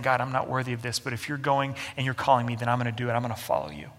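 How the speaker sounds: bow your head and give in gently; humble surrender. Retold or imitated. Humble Moses answering God, soft and yielding. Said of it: "humility would be looking at God and saying".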